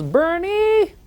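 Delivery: calling out high across the house; shouting a name. Retold or imitated. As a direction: yell the name to someone outdoors. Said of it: "Shouts out".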